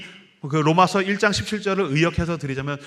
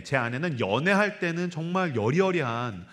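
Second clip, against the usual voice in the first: ordinary delivery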